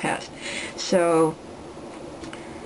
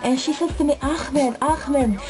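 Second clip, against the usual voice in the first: put-on higher-pitched accented storyteller, in character; put-on accent and raised pitch, telling it as the man himself; Ahmed; 5 semitones higher than usual. Classmate recounting the stolen PSP saga, in a higher accented impersonation. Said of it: "here's the story of Ahmed".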